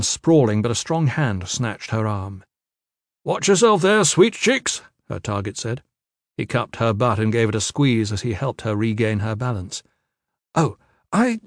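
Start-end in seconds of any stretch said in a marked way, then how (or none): 3.2-4.8 high, loud jovial leer; brash lecherous man. A narrator's reading. Target to the woman who collided with him, brashly flirtatious. 10.5-11.4 high-pitched, startled stammer; flustered confusion. A narrator's reading. Tia to the man who grabbed her, confused and flustered. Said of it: "didn't have to feign her confusion".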